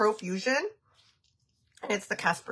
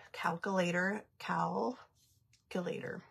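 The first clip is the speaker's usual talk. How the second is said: slow whispered word to herself; 3 semitones lower, 10 dB quieter, slower than usual. Real, not acted. Muttering to herself while doing the math, whispered. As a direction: breathe the word out slowly, unvoiced.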